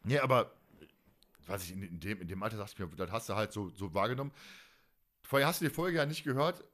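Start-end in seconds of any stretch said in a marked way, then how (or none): none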